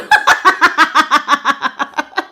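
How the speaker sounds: big loud real laugh, almost shouting; loud burst of laughter. Real, not acted. Reader laughing at her own slip to chat, loud laughter. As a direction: let out a loud laughing whoop.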